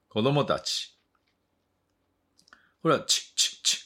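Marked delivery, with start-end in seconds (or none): 3.0-3.9 "チッチッチッ" as short voiceless bursts; rapid, separate "ch" clicks on "チッチッチッ"; whispered-out "チッ" with no vowel; CH sound demo. Shown as a demonstration. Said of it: "この音ね。".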